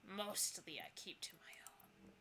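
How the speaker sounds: hushed, breathy ghost aside; whisper. Acted. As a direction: breathe it out secretly. Ghost confiding softly.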